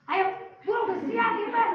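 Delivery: put-on a mother barking at her child to get ready; tense rushing. Shown as a demonstration.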